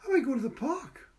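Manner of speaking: put-on high, eager child's question; boy's voice. A narrator's reading. Boy asking Patricia about the park, high and eager. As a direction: lift it high like a hopeful boy. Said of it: "or I won't take you".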